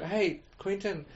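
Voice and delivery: mock calling out. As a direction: playfully hail someone, stretching it out. Imagined call to the director.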